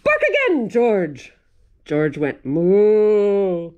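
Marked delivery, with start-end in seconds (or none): 0.0-1.3 firm mother call; commanding mother. Acted. 2.4-3.7 cow moo. Acted. George the dog moos at the vet, loud moo.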